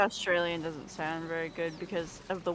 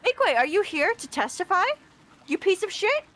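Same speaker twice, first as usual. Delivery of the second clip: higher teasing call-out; mock accusing; 12 semitones higher than usual. Real, not acted. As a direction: needle her, playfully indignant. Teasing friend in chat.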